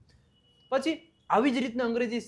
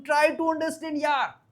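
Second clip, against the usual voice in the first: higher, drawn-out exasperated appeal; exasperated plea; 7 semitones higher, much slower than usual. Acted. Teacher voices pleading with a friend to understand, exasperated. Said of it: "આપણે બોલું".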